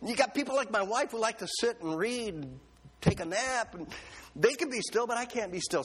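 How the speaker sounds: high, whiny protest to the Lord; complaining to God. Retold or imitated. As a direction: plead your case high and aggrieved. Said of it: "I said".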